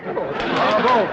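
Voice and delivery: loud, gushing bravo; excited praise. Acted. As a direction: cheer it out with delight. Banker gushing over rival's bravado.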